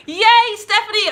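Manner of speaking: high, loud happy shout of her name; excited cheer. Real, not acted. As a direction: cheer for a viewer. Thanks viewer for hoodie order.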